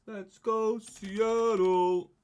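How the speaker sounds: high, loud cheer for the pick; excited cheer. Real, not acted. Streamer cheering the wheel landing on Seattle, loud and high. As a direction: punch the air and call it out.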